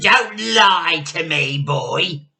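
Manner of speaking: very loud, menacing hag's yell; Grandma shouting. A narrator's reading. Grandma shouts at George not to lie. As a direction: bark it down at the boy. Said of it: "the enormous old hag shouted".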